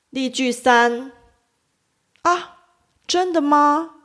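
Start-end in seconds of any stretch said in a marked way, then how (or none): 2.2-4.0 high “啊” with a gasp of surprise; 惊讶地高声“啊”; surprised. Shown as a demonstration. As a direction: react to gossip with a high, astonished “啊”. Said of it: "惊讶".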